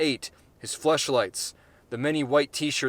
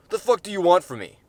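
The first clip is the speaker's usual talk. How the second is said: higher, louder angry demand; angry Gary; 7 semitones higher, 6 dB louder, faster than usual. Acted. Gary snaps at the jeering crowd, angry. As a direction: hurl it at the crowd.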